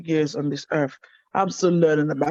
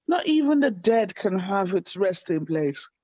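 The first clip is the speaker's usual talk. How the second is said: ordinary talk, nothing marked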